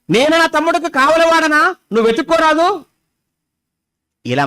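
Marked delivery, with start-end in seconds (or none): 0.1-2.8 higher, insolent retort; arrogant Cain. Retold or imitated. Cain answering God, higher insolent retort. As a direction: throw the question back defiantly. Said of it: "వాడు కయీను మాట్లాడుతున్నట్టే చూసారా".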